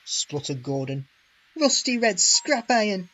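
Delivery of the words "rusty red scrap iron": higher, louder indignant hiss. A narrator's reading. James hissing his complaint to the Fat Controller. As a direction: spit the insult out. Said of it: "hissed James".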